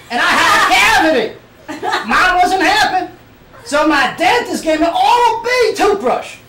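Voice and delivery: mock Southern accent bellowed as a toothbrush commercial; Southern accent shouted. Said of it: "do this in a Southern accent".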